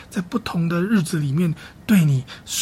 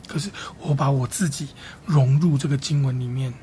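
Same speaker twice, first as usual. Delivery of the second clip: usual speaking voice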